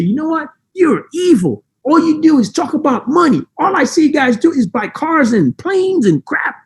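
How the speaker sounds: high-pitched mocking accuser; mock critic. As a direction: put on a high, whiny-critic voice. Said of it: "some people are gonna say".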